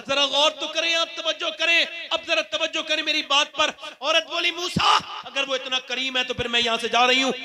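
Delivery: high, loud call to the crowd; preacher urging the gathering to listen closely; fervent preaching. Acted. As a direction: demand the crowd's attention. Urging crowd at full force.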